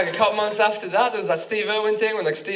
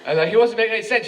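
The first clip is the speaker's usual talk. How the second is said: ordinary talk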